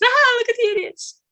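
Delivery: mock them as they laugh at us; mocking sneer. The powerful jeering at masked people, high mocking voice. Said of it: "they throw it in our faces, like".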